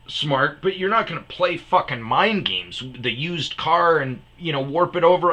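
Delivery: high-pitched, exasperated arguing; indignant retelling of a row; retold angry pushback. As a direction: argue back hotly, cutting the tech down. Retold angry argument with tech.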